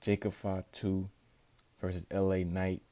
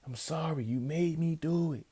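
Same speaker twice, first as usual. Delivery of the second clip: high, pleading excuse; mock guilty excuse; 8 semitones higher than usual. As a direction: blame him while apologizing. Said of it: "he tells him".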